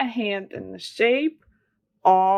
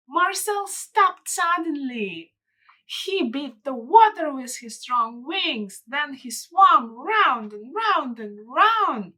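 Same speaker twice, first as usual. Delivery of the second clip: storybook reading, a little higher, slowing toward the end; story narration; 5 semitones higher than usual. Acted. Reading a picture book to children, gentle storytelling voice. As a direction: read it like bedtime narration for kids.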